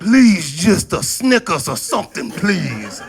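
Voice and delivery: loud laughing plea; hammed-up begging. Shown as a demonstration. Boy begging for a Snickers, hammed-up plea. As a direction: overdo the pitiful beg. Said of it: "I would quiver the lip".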